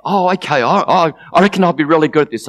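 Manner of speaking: high, quick, excited babble; eager agreement. Acted. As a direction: agree like a keen child. Shame eagerly agrees to play.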